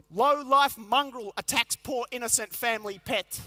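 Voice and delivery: slow, punchy tabloid headline; headline read. Pretend. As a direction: announce it like a front page. Reading the real headline.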